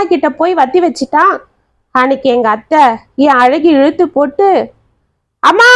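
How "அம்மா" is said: short sharp cry of pain, the word Amma; a girl burnt with a hot ladle, crying out. A narrator's reading. Burnt by her mother, a pained cry. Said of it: "அழகின் அலறல் சத்தம்".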